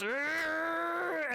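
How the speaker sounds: very high, loud furious roar; angry roar. Acted. Lizard folk roaring at the demon before the brawl. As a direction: let out a rage-filled roar. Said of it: "he goes".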